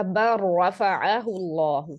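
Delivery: बर रफ़अहुल्लाहु recited slowly with a doubled R and no L; slow Quranic demo, L fused into R in बर रफ़अहुल्लाहु; merged recitation. Shown as a demonstration. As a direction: draw out बर रफ़अहुल्लाहु, holding the merged R. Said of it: "totally आपने मर्ज कर देना है".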